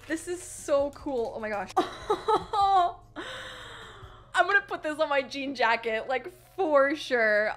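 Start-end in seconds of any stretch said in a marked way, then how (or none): 1.7-4.1 long, high squeal of laughter; overcome, squeal-laugh with joy; delighted squealing laugh. Real, not acted. Fan seeing sparkly pins, squealing laugh.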